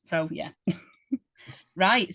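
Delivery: normal talking voice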